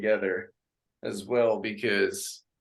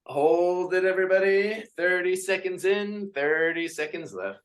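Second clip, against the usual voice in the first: upbeat class count, sung out; fitness instructor calls; 7 semitones higher than usual. Acted. Instructor counting reps for the class. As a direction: lead the class through reps.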